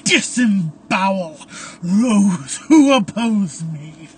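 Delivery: loud, drawn-out menace; gloating, laughing villain. A narrator's reading. Caleb mocks the priest's prayer, loud gloating menace. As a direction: revel in the threat, loud and slow. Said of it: "Caleb laughing".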